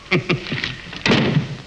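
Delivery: amused chuckle. Acted. Suitor amused that Shobha is shy, chuckling. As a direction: let a little laugh bubble out.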